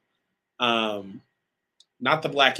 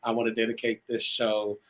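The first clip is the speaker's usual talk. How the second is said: ordinary talk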